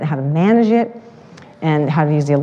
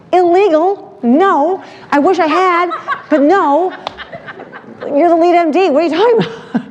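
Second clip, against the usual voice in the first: incredulous; 10 semitones higher than usual. Retold or imitated. Telling the lead MD filming was illegal, high laughing disbelief. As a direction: scoff at the absurd question, laughing.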